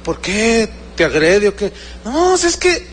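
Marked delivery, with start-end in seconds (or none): 2.0-2.8 high-pitched, fed-up complaint; lightly acted exasperated whine; mock exasperation. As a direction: imitate a son at his wits' end. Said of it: "ya no aguanto a mi papá".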